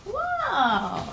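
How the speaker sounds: plain reading voice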